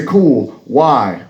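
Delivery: ordinary talk, nothing marked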